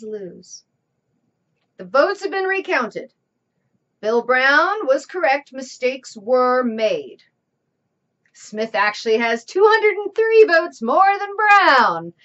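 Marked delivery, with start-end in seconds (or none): 1.8-12.1 loud announcement. Acted. Announcing recount results.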